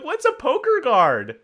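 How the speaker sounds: high, loud, drawn-out bafflement; baffled outcry. Retold or imitated. Retelling years of confusion over the quote, baffled high wail.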